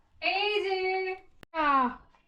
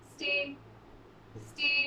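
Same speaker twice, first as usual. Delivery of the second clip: normal talking voice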